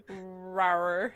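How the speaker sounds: low playful dinosaur rawr. Pretend. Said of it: "So what does a dinosaur sound like?".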